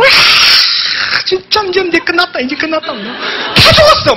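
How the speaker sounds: 높은 목소리로 신나서 외치듯; 혼자 기뻐 날뛰듯 들떠서. Retold or imitated. (전화 끊고 혼자 신나서 외치는 목소리)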